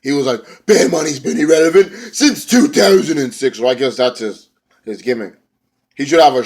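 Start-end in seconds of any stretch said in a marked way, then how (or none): 0.7-3.6 high put-on promo voice; mock a wrestler's pet taunt; higher-pitched, hammed-up wrestler impression; imitated wrestler's catchphrase. Imitating Bram's repeated catchphrase.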